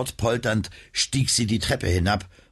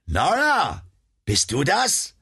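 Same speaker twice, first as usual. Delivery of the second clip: calling out; 9 semitones higher, slower than usual. Acted. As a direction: call out, unsure who is there. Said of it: "hörte sie eine Stimme rufen".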